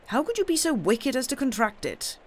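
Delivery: high-pitched, reproachful question; shocked reproach. Acted. Uncle to indebted nephew, shocked reproach. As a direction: reproach him for his debts.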